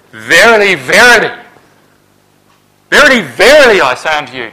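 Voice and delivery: loud, high, repeated "Verily"; emphatic verily. Retold or imitated. Preacher quoting Jesus, emphatic and loud. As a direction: ring out each "verily". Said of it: "the same emphatic statement".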